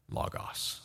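breathy whisper on Logos; whispered reveal. Acted. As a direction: drop to a whisper for the big word. Climax of quoted scripture.

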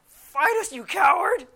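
full-blast, high-pitched challenge; angry yell. Retold or imitated. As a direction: scream it across the mat in outrage. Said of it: "I was so angry".

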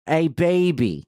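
broad Delaware greeting; Delaware accent. Shown as a demonstration. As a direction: lay on the Delaware vowels. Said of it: "Ours would be like".

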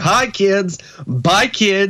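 put-on bright cheesy kids' host. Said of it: "he would start".

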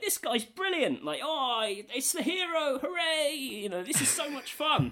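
high, slow mock villager cheer, laughing at the end. Telling a friend about the game's crowd, high mock cheer.

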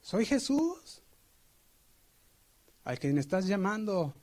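high, slow answer; Jesus answering the boy. Retold or imitated. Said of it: "se oye una voz".

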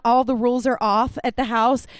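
usual speaking voice

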